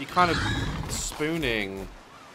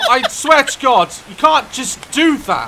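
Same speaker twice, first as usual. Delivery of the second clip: loud, high startled protest; shaken outburst; 10 semitones higher, 13 dB louder, slower than usual. Real, not acted. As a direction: still rattled from the scare, yelling. Reacting after being scared.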